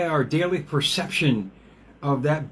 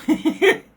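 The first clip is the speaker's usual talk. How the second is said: burst of laughter; 6 dB louder than usual. Real, not acted. Laughing at his own past.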